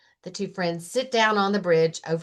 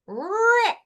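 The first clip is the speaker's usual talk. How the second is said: dramatic rip; 13 semitones higher than usual. A narrator's reading. Narrator voicing the map tearing, high and sudden. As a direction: snap the word out.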